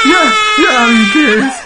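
high, loud, slow screamed words; shrieking in fright; terrified scream. Real, not acted. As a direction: scream it out in real terror. Jump scare in horror game.

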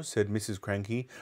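ordinary delivery, nothing marked

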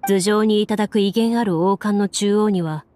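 ordinary talk, nothing marked

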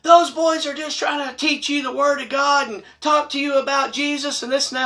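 high, mocking nasty woman voice; high nagging church lady impression; mocking the hateful mom. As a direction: sneer it like the pushy church mom. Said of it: "Hateful, rude, nasty".

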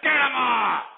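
loud, high bark of dismissal; sudden explosive order; furious shout. Real, not acted. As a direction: yell to have the caller cut off. Host orders caller cut off.

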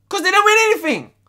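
exasperated shout. Real, not acted. Shouting point in the rant.

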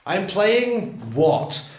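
very slow, rising on "what"; teacher eliciting the next word; prompting question. Shown as a demonstration. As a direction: draw the answer out of the class slowly. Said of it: "the next word from any group".

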